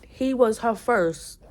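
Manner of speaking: normal talking voice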